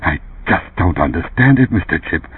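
quiet uneasy mumble; mumbling. A narrator's reading. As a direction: mumble it nervously, half to himself.

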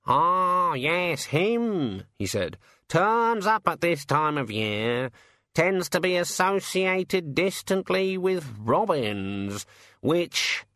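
a put-upon bird griping; grumbling raven. Acted. Raven complaining about the bird table to the rat, aggrieved.

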